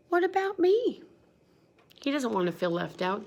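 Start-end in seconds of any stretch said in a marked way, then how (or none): 0.1-1.0 very high plaintive question. Shown as a demonstration. Teacher repeating Bear's question, very high and plaintive. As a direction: ask it feeling left out. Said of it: "with his question mark".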